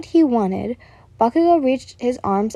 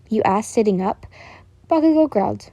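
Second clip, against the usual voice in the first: plain reading voice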